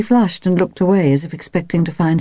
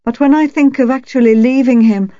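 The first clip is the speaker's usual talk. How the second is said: loud, high, agitated, breaking off; confused; 7 semitones higher, 6 dB louder than usual. A narrator's reading. Wife imagining leaving her husband, confused and agitated. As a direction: build in agitation, then stop short. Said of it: "she broke off in confusion".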